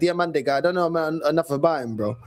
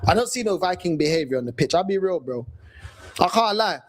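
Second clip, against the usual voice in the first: usual speaking voice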